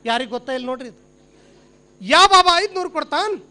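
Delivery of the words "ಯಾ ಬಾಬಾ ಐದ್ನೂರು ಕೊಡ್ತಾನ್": loud, slow showman's call; snake charmer. Acted. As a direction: work the crowd with a drawn-out question. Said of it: "ನೋಡಿದ ತಕ್ಷಣ ಇವಂಗ್ ನೆನಪಾಗಿಬಿಡ್ತು".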